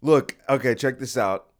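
ordinary delivery

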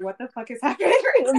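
the aunt baffled while laughing; laughing mock outrage. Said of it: "pulling through my hair like".

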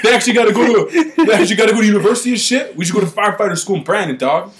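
quick, high-pitched firefighter bro; hammed-up firefighter guy; firefighter impression. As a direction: do a riled-up firefighter mocking a rival crew. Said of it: "That's what all firefighters sound like".

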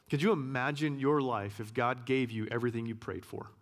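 ordinary talk

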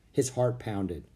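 usual speaking voice

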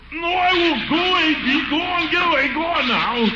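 high panicked squeal; frantic, shoo it off. Acted. Panicking at bee on nose.

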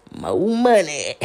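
slow, lightly voiced catchphrase imitation; hint of a comedian's bit; Marlon catchphrase. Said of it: "screw up his little face and swing his little hands".

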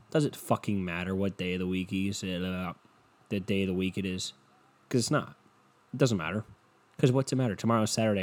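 usual speaking voice

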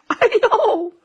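high-pitched mock oops; sudden high cry of mishap; startled yelp. Dragon caught while taking off, startled high yelp.

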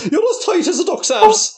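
high, lilting Irish caricature; mock Irish accent. Shown as a demonstration. Trading accents on a podcast, mock Irish accent. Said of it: "Irish accents".

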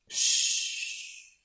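soft unvoiced shushing. A narrator's reading. Rabbit hushes his friend near the bear, soft shush. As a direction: hush them to hide.

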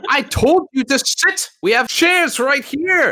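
high, loud exasperated yell; officer losing his temper, shouting orders; angry shout. Acted. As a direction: yell it at a disobedient recruit. Officer loses temper at recruit.

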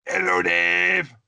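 impression voice. Doing listener-set impressions.

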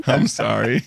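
high squealing laugh, then a giggled sorry; laughing apology. Real, not acted. Laughing during a comic skit.

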